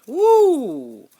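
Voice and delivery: loud high whoop of delight; excited whoop. Real, not acted. Reader whooping at a card she loves. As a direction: let out a big whoop at the card.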